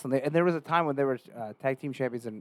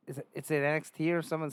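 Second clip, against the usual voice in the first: ordinary talk, nothing marked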